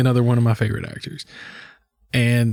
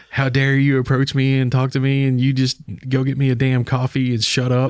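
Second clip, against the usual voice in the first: ordinary talk, nothing marked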